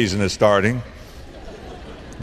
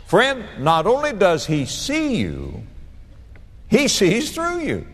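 rising emphasis; 7 semitones higher than usual. Real, not acted. Preacher building emphasis.

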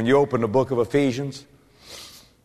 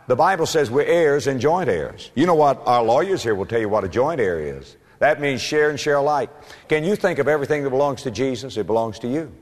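normal talking voice

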